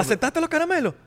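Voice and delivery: very high mock accusation; squeaky put-on parental outrage; interrogating mom. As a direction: demand an answer like an alarmed mother. Plays mom interrogating about candies.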